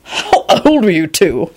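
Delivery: slow, startled question. A narrator's reading. Quentin seeing the young boys, startled. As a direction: ask in taken-aback disbelief. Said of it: "said in a startled voice".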